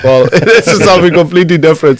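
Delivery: quick retelling broken by a laugh; laughing quote. Retold or imitated. Munster player recalling his surprise in Dingle, laughing. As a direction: laugh through the surprise of a new accent. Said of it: "I said".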